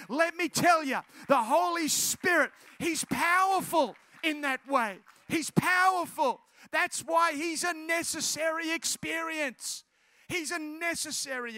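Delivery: preacher at full force; forceful preaching. Real, not acted. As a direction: drive each phrase home to the congregation.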